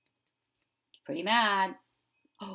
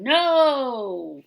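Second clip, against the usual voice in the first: loud, higher bratty shout; tantrum no; 5 semitones higher, 11 dB louder than usual. Acted. Teacher acting the pigeon's tantrum, shouting no. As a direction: stamp it out like a toddler. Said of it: "throwing a tantrum and saying".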